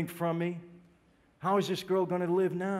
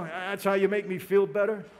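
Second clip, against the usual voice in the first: ordinary talk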